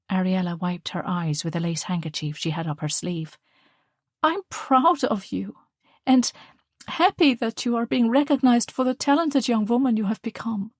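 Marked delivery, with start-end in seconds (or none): 4.2-10.7 higher, louder, glowing praise; proud mother. Acted. Mother praising her daughter's debating win, proud. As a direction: beam with pride at your daughter.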